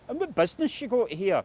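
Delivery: suspicious demand; menacing local hardman. Retold or imitated. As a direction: lean on him like a street heavy. Dubious characters question Christie.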